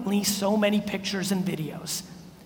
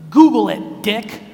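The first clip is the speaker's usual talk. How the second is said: loud retort; 8 dB louder, slower than usual. Acted. Snapping back at the asker.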